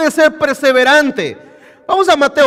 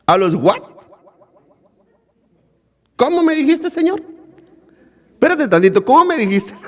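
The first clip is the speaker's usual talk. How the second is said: mock offended; 4 semitones higher than usual. Offended woman acting out.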